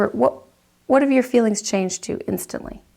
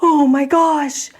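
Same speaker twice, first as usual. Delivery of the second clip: dismayed exclamation; 5 semitones higher, much slower than usual. Retold or imitated. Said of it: "You're like".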